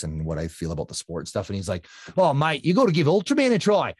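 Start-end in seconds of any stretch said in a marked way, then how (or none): 2.2-3.9 imitating an Australian mate, higher and louder; a cheerful Australian accent, put on loud and high. Imitating Nick's Australian accent while urging a friend to try Ultraman. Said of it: "I won't wreck his accent anymore".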